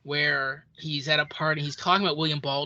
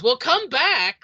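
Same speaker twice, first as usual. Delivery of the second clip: high, louder, lilting Capote impression; Truman Capote voice; 10 semitones higher, 6 dB louder, slower than usual. Capote calling after the departing fan, in a high lilting Capote voice. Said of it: "like that".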